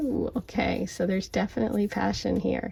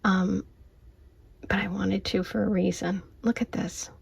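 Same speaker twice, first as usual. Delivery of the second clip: whispering. Real, not acted. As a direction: whisper so nobody wakes up. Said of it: "even though I have to whisper".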